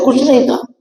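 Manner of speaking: high, brushing-off reply; evasive denial. Retold or imitated. Servant plays down what happened to the scholar, evasive. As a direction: wave it away as nothing.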